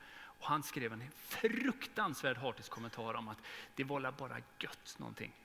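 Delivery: usual speaking voice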